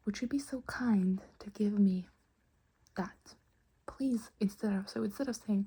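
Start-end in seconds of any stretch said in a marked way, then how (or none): none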